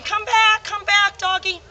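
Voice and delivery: high sing-song come-back call, mildly acted; coaxing call to the dog. Trainer mimics calling the dog back, high coaxing call. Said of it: "So rather than".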